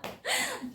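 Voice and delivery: sudden high burst of laughter. Real, not acted. Laughs at own joke. (ऊँची खिलखिलाहट)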